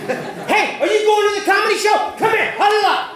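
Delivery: high, loud mock English street voice; hammed-up English accomplice rallying friends; conspiring English audience. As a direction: call the gang over in a high put-on voice. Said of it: "What did you guys do?".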